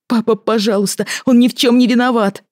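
high-pitched, anxious plea to a father; urgent pleading. Acted. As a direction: beg him, voice lifted high. Daughter pleads for captive man.

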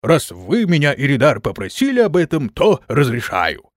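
ordinary delivery, nothing marked

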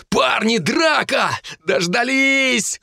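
high, excited schoolboy shout; overjoyed high-pitched cry; gleeful Cooper. A narrator's reading. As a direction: yell happily to rally the crowd, drawing out the last word. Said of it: "воскликнул счастливый Купер".

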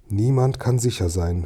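ordinary delivery

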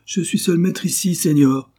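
high, loud haughty protest; indignant shout. Acted. Duke to the doctor and judge, loud indignant protest. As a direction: assert your authority with outrage. Duke asserting mastery, enraged.

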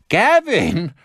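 high, loud cry of horror; shrieked in shock; horrified scream. A narrator's reading. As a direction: scream the name, appalled. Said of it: "schrie Rosenbaum entsetzt".

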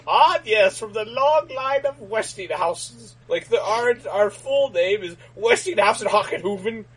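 hammed-up German aristocrat; German accent. Mock German Westinghouse voice.

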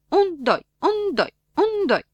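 loud, high marching count. Acted. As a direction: call the step like a drill leader. (numărătoare de marș, tare și sus)

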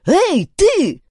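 high, loud call; sharp hail across a distance; shouting. A narrator's reading. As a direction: yell after someone hurrying away. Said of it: "крикнул Дункан".

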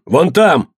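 high-pitched call of discovery; pointing-out shout. A narrator's reading. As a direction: point out the culprit with a cry. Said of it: "закричал Иодан".

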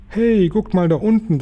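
put-on high excited boy's call. A narrator's reading. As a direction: call the others to look, pitch way up. Boy in flight calls to family.

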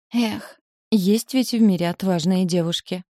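a wistful, acted sigh on the opening word. A narrator's reading. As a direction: let a mild sigh lead into the line. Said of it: "вздохнула Винса". (с лёгким наигранным вздохом)

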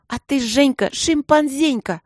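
высоко, громко, растянуто обзовись; задиристая обзывалка нараспев; девчачья дразнилка. A narrator's reading. (протяни кличку с вызовом)

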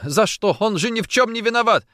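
high, loud, quick tearful protest; sobbing protest. A narrator's reading. Woman defends arrested man to officer, sobbing protest. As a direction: protest his innocence through sobs. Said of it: "всхлипывала она".

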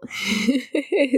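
real nervous giggle; nervous laugh. Real, not acted. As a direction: giggle from nerves on air. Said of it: "me estoy riendo, pero es mi risa nerviosa". (risa nerviosa)

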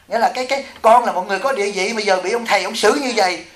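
ordinary talk, nothing marked

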